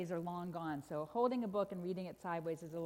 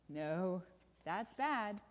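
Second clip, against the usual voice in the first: ominous correction; much slower than usual. Acted. Story refrain, contradicting the delight.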